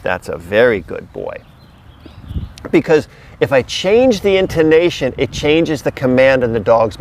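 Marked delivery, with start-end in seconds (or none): 0.0-1.4 reward the dog gently; praising the dog. Real, not acted. Praising the dog after command.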